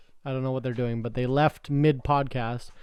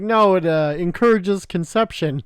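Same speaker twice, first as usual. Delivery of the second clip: retold quote; 4 semitones higher, 7 dB louder than usual. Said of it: "And she's like".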